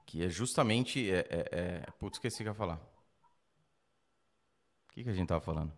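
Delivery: losing the thread, voice fading; trailing off. Real, not acted. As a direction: forget what you were saying mid-sentence. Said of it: "Deu branco". (perdendo o fio, a voz sumindo)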